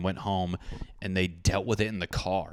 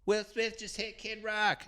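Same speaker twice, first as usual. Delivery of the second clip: wife's wrong take; 14 semitones higher, 6 dB quieter than usual. Retold or imitated. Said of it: "she says it right".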